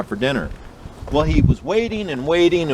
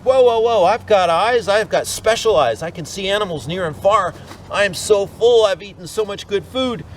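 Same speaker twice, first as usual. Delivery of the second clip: alarmed bluffing; 8 semitones higher than usual. Acted. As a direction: talk your way out of being eaten. Eyeless coyote bluffing the mountain lion.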